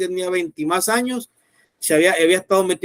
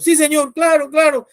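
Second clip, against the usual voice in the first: eager agreement; 6 semitones higher, 5 dB louder than usual. Retold or imitated. Boy eagerly agrees to receive Christ.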